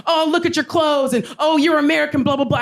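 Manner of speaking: high, sneering imitation; mocked heckler. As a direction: parody a drunk man's insults.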